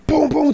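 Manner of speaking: loud, high booms; explosive vocal thuds; imitated thumping. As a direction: blast out the bangs suddenly. Imitates the noise returning.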